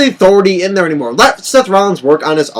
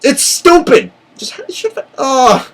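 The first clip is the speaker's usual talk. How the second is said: angry outburst; 9 semitones higher, slower than usual. Real, not acted. Fan ranting about ruined ending.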